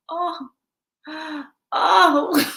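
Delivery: gasping, imitated Southern lady; Southern twang. Lady on the line reacting, mock Southern twang. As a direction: gasp it out like a Southern belle. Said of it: "Southern twangs".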